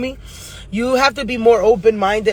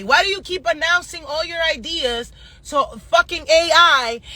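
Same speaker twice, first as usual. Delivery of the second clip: usual speaking voice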